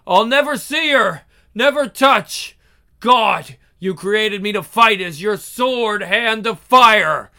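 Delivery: a pained, high prayer to God; anguished prayer. Acted. Angel prays in anguish.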